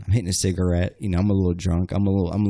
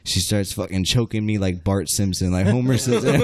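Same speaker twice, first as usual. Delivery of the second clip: ordinary delivery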